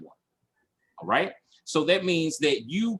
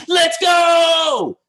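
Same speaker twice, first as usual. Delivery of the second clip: big hype shout, much higher and louder; yelling; 11 dB louder than usual. Retold or imitated. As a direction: shout it to pump the crowd up. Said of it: "by yelling".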